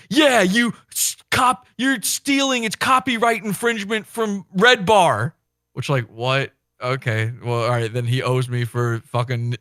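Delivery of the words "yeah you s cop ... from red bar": high, slow mocking whine; flustered accusation. Mocking the caller accusing him of copyright theft, high whine. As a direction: sputter the accusation like a rattled coward. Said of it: "and then he said".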